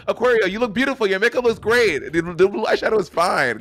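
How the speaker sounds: mock soothing Kim voice; imitating Kim. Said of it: "Kim just goes".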